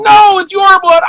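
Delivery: slow, high, loud insistence; wife shouting the correction; frantic shout. Retold or imitated. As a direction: yell it slowly so he gets it. Wife answering in panic.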